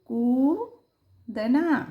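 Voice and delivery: कूदना very slowly, with a long oo; long vowel. Shown as a demonstration. Teacher sounds out a long u word, long vowel. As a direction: stretch कू, pause, then finish दना. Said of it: "ये बड़ा है तो बड़ा उच्चारण".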